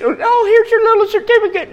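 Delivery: very high put-on mocking voice; high-pitched mock reaction; mocking imitation. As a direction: squeak it mockingly. Mimics a dismissive reaction to achievement.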